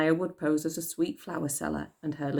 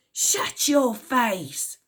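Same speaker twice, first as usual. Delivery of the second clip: slow menacing hissed threat; 7 semitones higher, much slower than usual. A narrator's reading. Unseen gang member threatening Elsie, slow hiss. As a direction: spit it out slowly. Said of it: "hissed an unseen voice".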